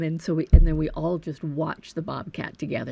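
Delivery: ordinary talk, nothing marked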